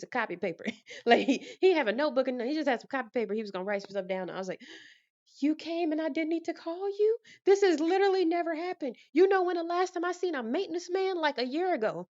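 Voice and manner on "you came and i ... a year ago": mock amazed. Greeting the maintenance man who showed up uncalled, incredulous. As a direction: act floored that he came unasked.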